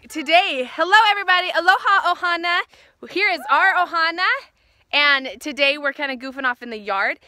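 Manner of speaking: loud, bright hello to viewers; vlog intro. Acted. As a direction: greet the channel like a host.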